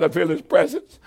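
normal talking voice

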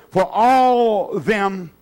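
very slow, drawn-out pulpit reading; scripture reading. Real, not acted. Preacher reading Isaiah to the congregation, slow scripture reading. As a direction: stretch the words out solemnly.

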